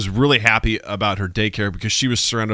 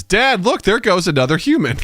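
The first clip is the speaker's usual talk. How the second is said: put-on much higher, louder child voice; mock toddler announcing; 9 semitones higher than usual. Toddler on a hike calling out to her dad. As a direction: shout it like an excited three-year-old. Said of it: "she points out loudly and says".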